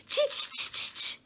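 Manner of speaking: high, loud monkey chatter. Acted. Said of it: "and said".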